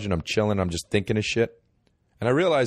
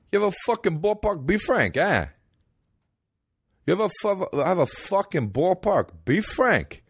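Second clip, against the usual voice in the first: put-on much higher, brash New York accent; 12 semitones higher than usual. Mock New Yorker asks again.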